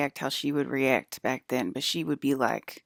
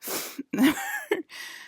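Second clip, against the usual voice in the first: high laugh. Real, not acted. Laugh after story.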